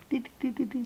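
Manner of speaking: put-on high, soft baby babbling; toddler babble. Daughter babbling happily.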